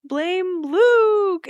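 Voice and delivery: high, drawn-out mock protest; Lorelai. Woman passing blame to the neighbours, mock wailing. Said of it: "Lorelai's like".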